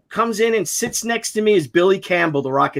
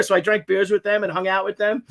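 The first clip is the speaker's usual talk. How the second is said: ordinary talk, nothing marked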